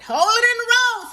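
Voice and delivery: same high, loud warning again; grandma's stern order. Retold or imitated. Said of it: "That's how she'd say it".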